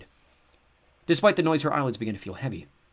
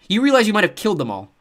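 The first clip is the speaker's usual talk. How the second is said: loud sharp accusation; accusing; 12 semitones higher, 12 dB louder than usual. A narrator's reading. Kendra blaming her brother, loud accusing. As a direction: throw the blame at him.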